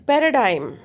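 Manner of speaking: first syllable of "paradigm" punched loud, then falling; stress on P. Shown as a demonstration. Teacher giving an example of syllable stress, "pa" of "paradigm" punched. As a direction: hit the opening "pa" of "paradigm" hard. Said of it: "stress on P".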